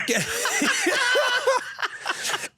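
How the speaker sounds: a high, bursting laugh at the joke; real laugh. Real, not acted.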